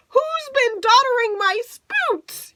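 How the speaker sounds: put-on high, slow, wailing baby alien voice; baby Bleem crying out high. A narrator's reading. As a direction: wail it like a tiny upset alien. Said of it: "he cried".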